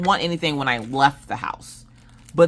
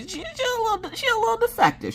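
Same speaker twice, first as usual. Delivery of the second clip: much higher, fast squeak; squeaky mock voice. Mom joking about her daughter, squeaky and high. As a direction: squeak it out comically.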